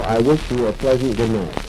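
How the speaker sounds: usual speaking voice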